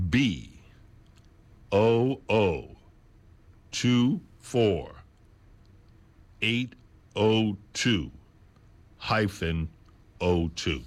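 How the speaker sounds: dictation pace with long pauses. Shown as a demonstration. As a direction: spell out each group slowly, leaving space to write. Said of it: "I'll say it again slower".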